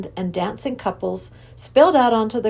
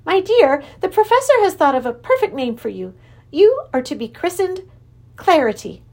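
high, loud, joyful creature king; delighted Wangdoodle king; 9 semitones higher, 5 dB louder than usual. Acted. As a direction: relish the name with delight. King tells wife her name.

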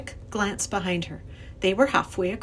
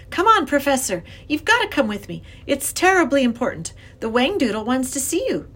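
put-on high, louder pleading child; urgent young girl; 6 semitones higher, 5 dB louder than usual. A narrator's reading. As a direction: urge the professor to hurry along. Girl urgently pulls professor along.

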